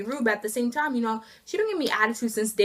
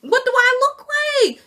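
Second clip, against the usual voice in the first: indignant; 12 semitones higher, 4 dB louder, slower than usual. Real, not acted. Indignant rhetorical question.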